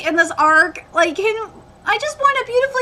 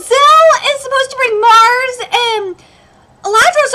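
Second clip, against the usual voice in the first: higher and louder, shouting outrage; furious rant; 10 semitones higher, 4 dB louder than usual. Real, not acted. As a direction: blow up at the changed canon. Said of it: "I'm so fucking mad right now".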